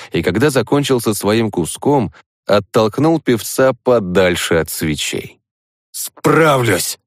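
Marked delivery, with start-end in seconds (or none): 5.9-7.0 strained, high spat retort; spat through teeth. A narrator's reading. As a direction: force it out through clenched teeth. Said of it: "выплюнул ему сквозь зубы".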